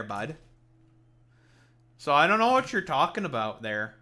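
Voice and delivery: mock Canadian banter, sarcastic and loud; playing an exaggerated Canadian heckler; overly Canadian. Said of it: "why are you being overly Canadian".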